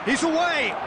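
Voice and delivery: high excited play-by-play with a shouted shot; excited commentary. Acted. Live play-by-play of an attack.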